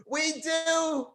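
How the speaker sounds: a squeaky little crowd voice answering; tiny high voice. Pretend. An imagined crowd answering him in a high squeak. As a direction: pipe it up high and small.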